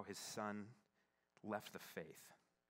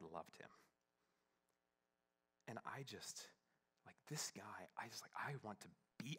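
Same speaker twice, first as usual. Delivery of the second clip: moved whisper; 7 dB quieter than usual. Real, not acted. Moved by the old pastor's story.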